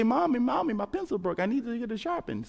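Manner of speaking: put-on a small kid calling Mommy; child asking mom.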